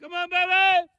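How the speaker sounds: very high, sustained calling voice; cattle call. Shown as a demonstration. Husband demonstrates his cattle call, very high holler. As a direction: sing it out high to cows two miles off. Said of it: "You guys wanna hear my cattle call?".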